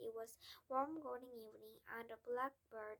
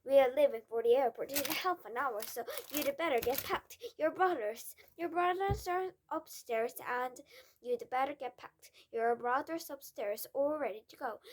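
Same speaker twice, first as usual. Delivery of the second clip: much louder stern father; loud, bossy father; 2 semitones higher, 11 dB louder than usual. Acted. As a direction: boss her around, loud and hurried. Father voiced in story.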